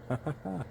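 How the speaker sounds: short chuckle at own joke; amused chuckle. Real, not acted. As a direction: let out a quick little laugh. Laughs at own innuendo joke.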